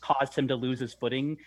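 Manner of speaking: ordinary delivery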